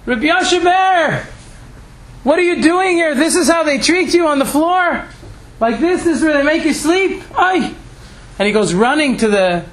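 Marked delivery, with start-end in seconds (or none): 0.1-7.7 shouting the rabbi's name. Retold or imitated. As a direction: cry out his name across the hallway, appalled. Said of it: "And he starts to shout".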